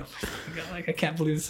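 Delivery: soft, despairing mutter; depressed. Retold or imitated. Fan losing the match, soft despairing murmur. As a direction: sink into the blanket in despair. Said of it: "I'm, like, depressed".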